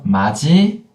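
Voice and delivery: 마지 flowing, final consonant sounded as j; fully linked. Shown as a demonstration. Teacher contrasts two spellings, 마지 linked fully. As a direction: say 마지 with no stop inside. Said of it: "This one".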